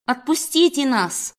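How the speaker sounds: acted hysterical cry, not full force; mild hysterical cry. Captive woman to her guard, hysterical cry. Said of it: "перешла на истерический крик".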